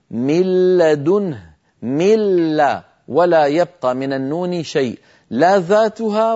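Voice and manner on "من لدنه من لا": slow recitation of "من لدنه", nun merged into a doubled lam; recited with idgham. Shown as a demonstration. Teacher showing the enlarged slide, reciting slowly with merged nun. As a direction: draw out "من لدنه" as "mil-ladunhu", no trace of the nun. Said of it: "ترونها أمامكم مكبرة".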